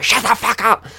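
high, loud, strained shout; retold yell. Passenger yelling at his brother to stop, reenacted shout. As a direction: yell it to silence him. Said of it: "I have to yell at my brother".

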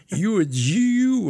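high, loud, slow Southern imitation; exaggerated Southern drawl. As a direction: stretch the vowels with mock Southern Y sounds. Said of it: "and more Y's".

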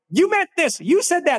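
hammed-up blame from someone misreading an email; mock accusation. Upset reader accusing the sender, in a high mock-indignant voice.

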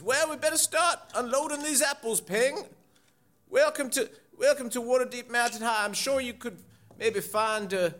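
high put-on character voice; chirpy old groundskeeper, proud of the school; Basil's voice. Groundskeeper shows students the new school, high character voice.

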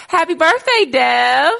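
loud, high, cheerful birthday call; excited greeting. Real, not acted. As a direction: shout it out with joy. Greeting guest on her birthday.

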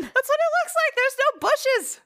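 very high, slow, squeaky protest; high-pitched mock indignation; squeaky indignant. Real, not acted.

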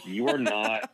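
usual speaking voice

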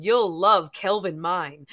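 mock ad tagline. Parody knockoff spray ad, slow low announcer. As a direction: sell the knockoff slowly. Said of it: "designer imposter's body spray".

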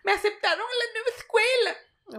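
high, perky put-on TV voice; mocking neutral-accent actress. Mocking the show's unnatural dialogue, a high perky parody. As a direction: parody the chirpy Disney actress. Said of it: "pero es porque tú".

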